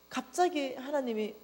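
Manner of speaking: usual speaking voice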